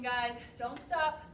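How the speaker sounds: normal talking voice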